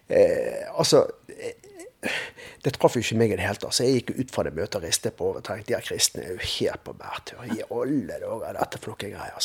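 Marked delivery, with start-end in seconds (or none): 5.7-9.4 whispered thought. Retold or imitated. Leaving the meeting, thinking to himself.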